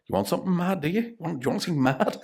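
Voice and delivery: high teasing dare; mock taunting. Teasing nervous radio hosts.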